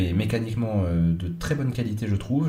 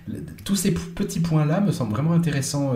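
ordinary talk

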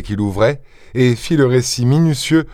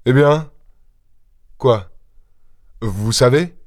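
anxious questioning. A narrator's reading. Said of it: "maître Goussot dit anxieusement".

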